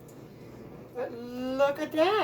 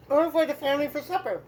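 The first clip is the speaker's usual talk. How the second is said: talking with a mouthful of food; mouth full; 3 semitones higher, 5 dB louder, faster than usual. Real, not acted. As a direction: say it round a bite of food. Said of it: "I'm doing this with my mouth full".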